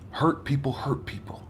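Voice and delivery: hushed whisper of the line; soft unvoiced repeat. Shown as a demonstration. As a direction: breathe it out as a whisper.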